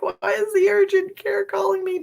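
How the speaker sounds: imitating her own bewildered reaction; retelling the surprised phone moment; puzzled surprise. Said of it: "And I'm like".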